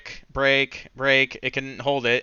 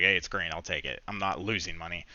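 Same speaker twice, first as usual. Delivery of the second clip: much softer, low resigned murmur; deflated relief; 6 semitones lower, 10 dB quieter than usual. Retold or imitated. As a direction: settle for a tiny win. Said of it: "I was just like".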